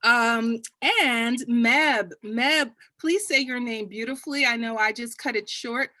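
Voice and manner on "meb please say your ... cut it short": ordinary talk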